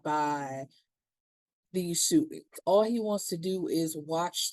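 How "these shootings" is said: usual speaking voice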